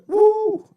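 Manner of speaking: high loud whoop of disbelief; excited whoop. Real, not acted. Whooping at the suspect on the tape, a loud high whoop. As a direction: let out a sudden high whoop. Reacting to a confession video.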